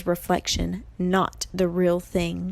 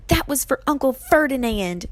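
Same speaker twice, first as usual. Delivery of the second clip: raised, defiant call; moderately yelled, higher-pitched retort; yelled back; 6 semitones higher than usual. A narrator's reading. Grover retorts to Medusa from above, yelling. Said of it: "Grover yelled back".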